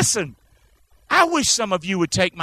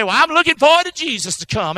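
high, louder singsong of a lazy believer; mock complacent believer; 7 semitones higher, 6 dB louder than usual. Preacher mocking a complacent believer, mock singsong. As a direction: parody the smug churchgoer.

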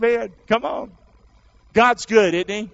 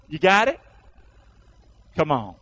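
ordinary talk

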